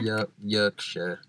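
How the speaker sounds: slow, hesitant go at Yorkshire; attempted Yorkshire accent. Shown as a demonstration. Testing it on a friend, in a tentative Yorkshire accent. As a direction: feel your way into a Yorkshire accent, slowly. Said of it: "Is that Yorkshire?".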